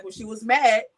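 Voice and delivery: ordinary talk, nothing marked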